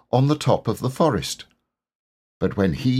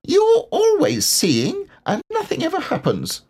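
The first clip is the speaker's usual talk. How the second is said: sad Roo. A narrator's reading. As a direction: whine it like a disappointed child. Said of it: "said Roo sadly".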